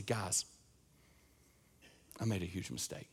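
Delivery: shamed confession. Retold or imitated. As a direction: admit it with your head down.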